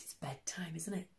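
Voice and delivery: soft bedtime whisper. A narrator's reading. Reader hushing children at bedtime, whispered. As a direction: hush it for bedtime.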